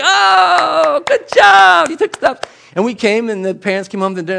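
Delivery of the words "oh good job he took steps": high, loud, thrilled praise; excited cheering. Retold or imitated. Babysitters cheering a toddler's first steps, thrilled and loud. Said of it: "we're like".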